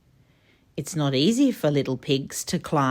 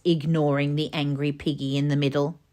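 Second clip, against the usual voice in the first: ordinary delivery, nothing marked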